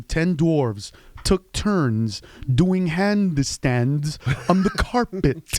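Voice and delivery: very slow, overarticulated tongue twister; diction drill. Shown as a demonstration. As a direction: drill every syllable slowly and carefully. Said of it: "I'm the guy at home going".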